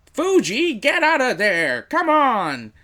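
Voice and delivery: high, loud, slow dubbed mother yelling; overacted anime dub. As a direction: ham up a frantic dubbed mother. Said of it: "pretty great over-the-top thing, acting choice".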